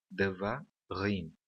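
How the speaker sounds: Devarim slowly, split before a stressed final "rim"; Devarim drawn out, accent on its final syllable; stress on last syllable. Shown as a demonstration. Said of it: "a última sílaba é a sílaba tônica".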